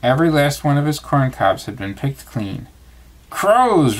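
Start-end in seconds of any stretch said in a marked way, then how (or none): 3.3-4.0 high, loud alarmed cry; sharp high shout of dismay; exclamation. Acted. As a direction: burst out at the ruined corn. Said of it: "Rabbit exclaimed".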